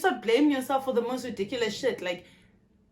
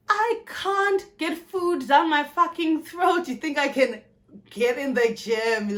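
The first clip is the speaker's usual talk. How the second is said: slow, loud, higher exasperation; exasperated retort; 5 semitones higher, 4 dB louder, slower than usual. Real, not acted. Answering gym advice, slow exasperated retort. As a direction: drag out the disbelief.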